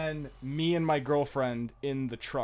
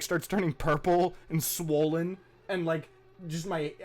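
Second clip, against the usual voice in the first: ordinary talk, nothing marked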